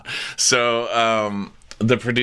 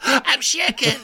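very high-pitched hammed-up panic; mock hysterical. Housewife insisting she is shaking, mock-hysterical. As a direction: imitate an overwrought squeal. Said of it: "does that thing where she's like".

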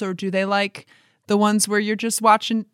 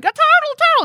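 put-on high squealing child's shout; excited little kid; 5 dB louder than usual. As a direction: squeal it like a thrilled toddler. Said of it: "a little kid can be like".

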